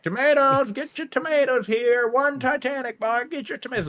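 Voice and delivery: hammed-up market vendor hawking tomatoes; street vendor call. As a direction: sing out your wares to the crowd. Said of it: "walking around like".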